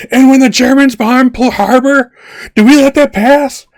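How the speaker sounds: high, loud ranting speech; rally the room like Bluto. Retold or imitated. Imitating Bluto rallying his frat brothers, ranting. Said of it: "Bluto's going".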